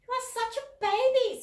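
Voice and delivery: high, loud mocking taunt; scornful big sister. A narrator's reading. Big sister mocking the little one, high and loud. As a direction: sneer it at your baby sister.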